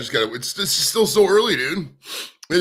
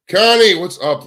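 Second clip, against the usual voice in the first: louder hello, name called out; loud greeting; 5 semitones higher, 10 dB louder, slower than usual. Real, not acted. Streamer greets a viewer joining the chat with a loud call-out. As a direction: sing out her name as she arrives.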